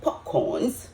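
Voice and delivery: "popcorns" for pecans, as puh-CORNS; pronunciation demo. Shown as a demonstration. Cook listing how different people say it, pecans turned into "popcorns". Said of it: "different people pronounce it different ways".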